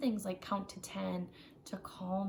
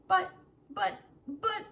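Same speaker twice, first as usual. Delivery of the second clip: higher, louder stammering buts; sputtering child protest; 9 semitones higher, 6 dB louder than usual. Acted. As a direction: splutter like a kid cut off.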